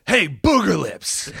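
high, drawn-out taunting call; Ernest. Acted. Ernest walks in on the troll, high taunting call. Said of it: "Ernest walks in, and he goes".

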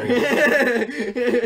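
high, loud, slow laughing tease; laughing teasing. Real, not acted. Teasing Chick-fil-A worker.

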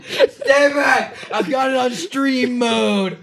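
high yelled mock threat; high panicked shout. Mock threat with spray bottle.

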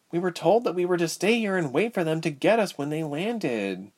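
put-on mildly anxious girl character; girl explaining. A narrator's reading.

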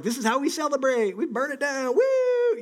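high-pitched crowing like a rowdy reveler; hammed-up party cheer ending in a whoop; rowdy celebration. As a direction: whoop it up as a mock rioter. Acts out rioters celebrating in streets.

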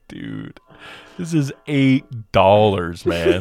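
mock-outraged friend, drawn-out and slow; complaining friend. Friend griping to the narrator about an eight-dollar cookie, mock outrage. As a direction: whine about the price in a put-on voice.

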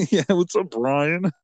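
high, put-on porpoise greeting; jokey high voice for a sea creature; talking porpoise. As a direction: greet a pal as a chatty porpoise. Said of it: "they can, like, say".

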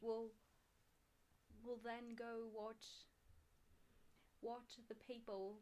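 slow, halting British accent attempt. Shown as a demonstration. Making up lines for a friend, halting British accent. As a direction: feel your way through British, slowly. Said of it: "Can you do a British accent".

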